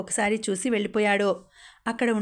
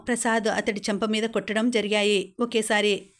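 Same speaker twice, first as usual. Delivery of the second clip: usual speaking voice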